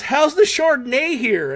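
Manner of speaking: high-pitched imitation of a customer; imitated bar customer. Acts out the date ordering.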